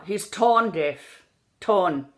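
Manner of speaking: "tone" drawn out as "tawn"; slow open vowel in "tone", no glide; Irish pure O. Shown as a demonstration. Coach drilling the vowel, "tone" like "tawn". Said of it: "So it never goes, "Oh".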